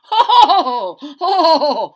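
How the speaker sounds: big booming laugh; loud laugh. Acted. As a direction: burst out laughing.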